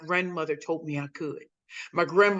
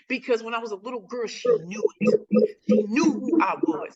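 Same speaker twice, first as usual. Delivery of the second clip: ordinary talk, nothing marked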